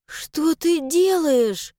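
slow, high, startled question; bewildered. Acted. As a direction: ask it, caught off guard. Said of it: "растерялась она".

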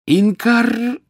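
very high, loud cry; Harry's yelled spell. A narrator's reading. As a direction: cast it with a piercingly high yell. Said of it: "завопил Гарри". (очень высокий вопль заклинания)

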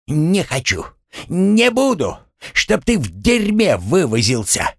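high, tantrum-like refusal; enraged. Acted. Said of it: "взъярился Гремлун". (разъярённо, на высоких тонах)

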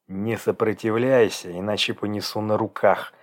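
usual speaking voice

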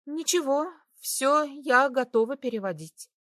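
lightly voiced young woman; light female voice. A narrator's reading. Irina answers, arms crossed. (слегка обиженный женский голос)

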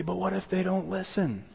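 high, quiet fretful question; imagined doubter. Pretend. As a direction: voice the nervous objector. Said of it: "You say".

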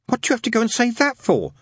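put-on high-pitched boyish scolding; Adam, exasperated schoolboy. Boy scolds friend for speaking out, exasperated. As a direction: complain with the pitch right up.

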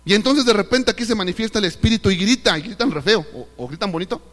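normal talking voice

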